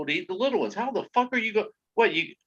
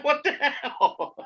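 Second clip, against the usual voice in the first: laughing disbelief; 10 semitones higher, slower than usual. Retold or imitated. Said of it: "I'm like".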